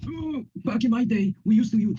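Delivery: lightly voiced grandpa starting a back-in-my-day story; old-timer voice. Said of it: "Hi, Grandpa".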